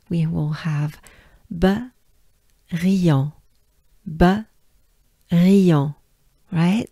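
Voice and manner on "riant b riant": "riant" louder, with a French R and nasal an; drilled riant. Shown as a demonstration. Teacher breaking the name into drill parts. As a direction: separate "B" from "riant". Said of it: "let's break it down".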